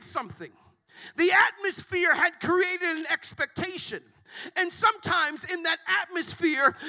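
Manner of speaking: loud, high, driving sermon cadence; full-force preaching. Acted. Pastor exhorts Impact Church to speak up, shouting. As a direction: preach it to the rafters.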